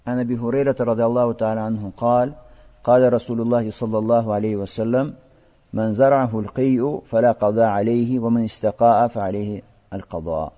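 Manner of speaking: quick, practised chant of the Arabic text; Arabic hadith recitation. Acted. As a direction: recite the hadith fluently, like a scholar.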